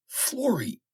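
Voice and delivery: the word catches in his throat; choked. A narrator's reading. Denman recognising Florrie, choking up. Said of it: "he said in a choke".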